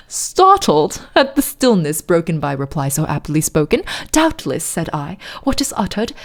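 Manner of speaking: poem recited in put-on British; hammed-up British poetry reading; fake British accent. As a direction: read dramatic verse in a mock British accent. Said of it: "middle of a fake British accent".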